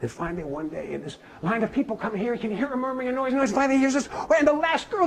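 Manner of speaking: plain reading voice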